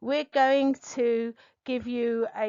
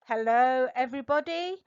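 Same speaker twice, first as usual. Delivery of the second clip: normal talking voice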